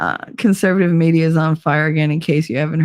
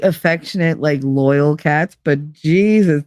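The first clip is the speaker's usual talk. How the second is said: normal talking voice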